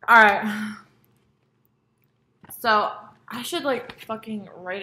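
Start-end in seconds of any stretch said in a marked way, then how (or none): none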